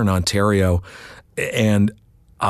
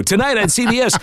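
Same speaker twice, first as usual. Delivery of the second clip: promo announcer; 13 semitones higher than usual. Retold or imitated. Demo of his promo read.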